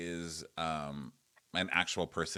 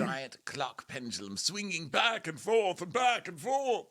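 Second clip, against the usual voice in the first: very high, slow singsong swing; hammed-up impression; 12 semitones higher, slower than usual. Retelling the favorite Nicolene moment, high theatrical impression. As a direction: swing the voice grandly, drawn out and high. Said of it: "when she first introduced Nicolene".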